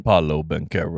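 very low name announcement; deep-voiced imitation of a self-introduction; Paolo Banchero. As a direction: drop deep to imitate an athlete saying his name. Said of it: "say it, do it again".

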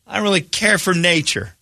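lightly voiced picky dater; blunt refusal. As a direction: shut it down bluntly. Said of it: "And she goes".

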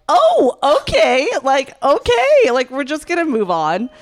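very high, loud, over-bright okays; mock suppressed amusement. Teacher hiding laughter at student's look, high strained politeness. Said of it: "trying to hold back her laughter".

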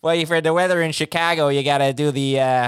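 put-on light Chicago accent; a hint of a Chicago accent. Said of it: "you could do the Chicago accent".

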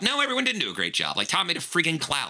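훨씬 높고 빠르게 쏘아붙이는 깐깐한 심사위원 흉내; 빠르게 높은 톤으로 딱 잘라 말하는 심사위원; blunt judge. Retold or imitated. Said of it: "glaring down in that Yorkie accent".

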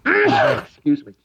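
coughing then excuse me; cough after sneeze. Real, not acted. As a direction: clear the throat after a sneeze. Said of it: "I'm gonna sneeze".

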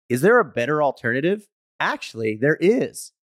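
brisk, upbeat ad voice; ad read. Acted. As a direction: read it like a sponsor spot. Reads sponsor ads.

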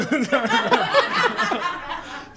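high burst of laughing; real laughter. Real, not acted. Amused friend laughing at the Seinfeld joke. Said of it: "es como Seinfeld".

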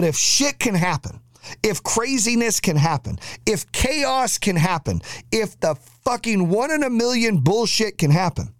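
pitched high, mounting exasperated list; insistent high-pitched build-up; building rant. Real, not acted. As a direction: ride high and stretch each "if" clause toward the climax. Building rhetorical climax of rant.

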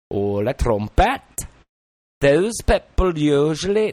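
lilting Welsh storyteller voice; Welsh accent. Shown as a demonstration. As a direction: tell it like a chatty Welshman. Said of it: "That's what I'm talking about with the Welsh dialect".

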